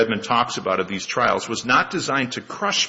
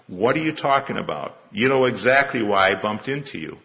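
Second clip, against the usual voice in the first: normal talking voice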